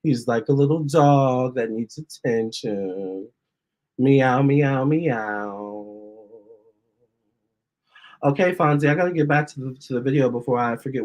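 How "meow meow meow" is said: playful meowing. Pretend. Meowing at cat Fonzie.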